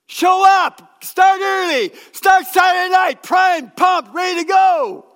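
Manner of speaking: high, loud rallying shout; shouted exhortation. Real, not acted. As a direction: fire them up at full voice. Preacher exhorting congregation.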